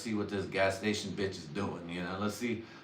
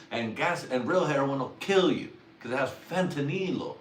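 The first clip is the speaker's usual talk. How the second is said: normal talking voice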